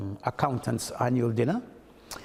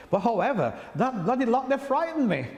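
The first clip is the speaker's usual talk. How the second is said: cheeky exclaim; 10 semitones higher than usual. Retold or imitated. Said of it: "I said".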